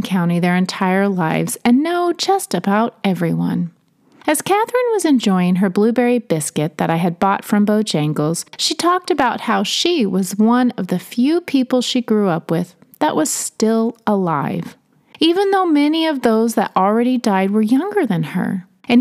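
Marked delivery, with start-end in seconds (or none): none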